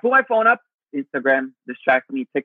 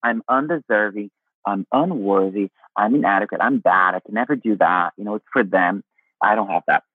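lower, heavy self-deprecating inner voice; voice of shame; 7 semitones lower than usual. Retold or imitated. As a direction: let shame speak in a low voice. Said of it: "And then there is a shame".

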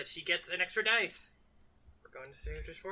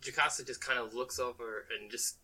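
usual speaking voice

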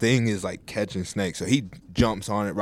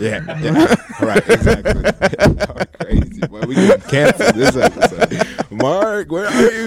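higher, laughing through the words; can barely get it out for laughing; real giggles while calling to the producer; 10 semitones higher, 5 dB louder than usual. Real, not acted. Cracking up at a joke.

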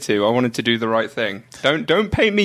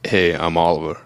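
much lower, deliberate American accent; put-on American accent; 7 semitones lower than usual. Shown as a demonstration. British co-host showing his own American accent, put-on and low. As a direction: drop the voice deep for a fake American accent. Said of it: "doing an American accent".

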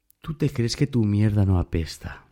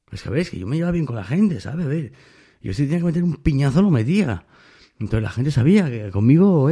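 ordinary talk

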